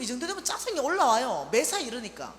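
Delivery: usual speaking voice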